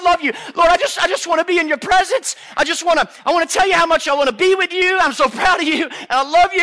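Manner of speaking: put-on high, loud little boy tumbling over his words; gushing child. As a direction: pour it out like an overexcited kid to Dad. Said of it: "This is how kids talk".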